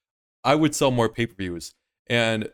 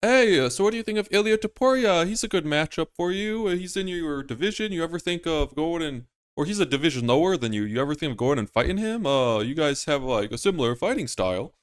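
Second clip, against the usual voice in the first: higher-pitched reporter putting a question; interviewer; 6 semitones higher than usual. Acted. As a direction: play a reporter casually quizzing a fighter, pitched up. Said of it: "And they ask Irwin".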